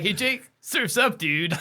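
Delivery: high, drawn-out surfer voice; hammed-up beach-bum drawl; mock surfer dude. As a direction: call your buddy out to the waves. Plays surfer buddies, others laugh.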